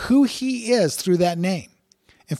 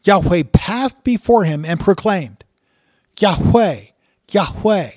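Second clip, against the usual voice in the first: louder, slow, proclaiming the verse; solemn scripture reading; 4 semitones higher, 6 dB louder than usual. Acted. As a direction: read it out like a proclamation from the pulpit. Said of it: "So let me just read it for you".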